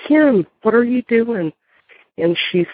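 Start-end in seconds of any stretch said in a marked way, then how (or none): 0.1-1.5 high, astonished calling out; shocked to see her friend; startled question. Retold or imitated. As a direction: ask in disbelief at the door. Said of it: "I'm like".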